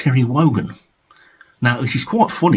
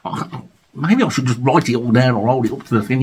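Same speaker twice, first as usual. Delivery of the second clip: ordinary delivery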